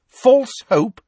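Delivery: indignant echo. A narrator's reading. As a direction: fling her words back at her. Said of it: "repeated Professor McGonagall".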